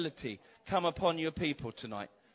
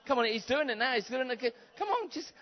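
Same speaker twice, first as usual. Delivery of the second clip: ordinary delivery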